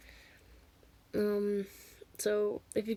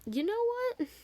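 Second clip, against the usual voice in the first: very high-pitched mock teacher retort; indignant retort; 14 semitones higher than usual. Teacher accused of denial to student, high indignant retort. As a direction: imitate her, voice shot right up.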